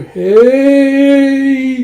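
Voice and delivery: high, loud call from afar. Acted. Yun calls out to the lost group. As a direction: shout to be heard far off. Strange voice calling again.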